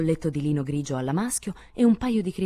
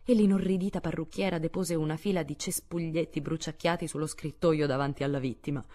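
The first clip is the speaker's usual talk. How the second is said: usual speaking voice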